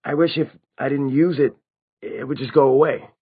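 bitter. A narrator's reading. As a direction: voice rising in bitter frustration. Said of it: "Simon said bitterly".

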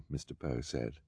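ordinary delivery

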